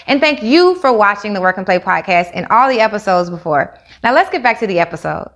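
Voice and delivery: host's sponsor read, brisk and upbeat. Acted. Host thanking listeners in a sponsor read, upbeat ad voice. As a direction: read the sponsor spot like a podcast ad.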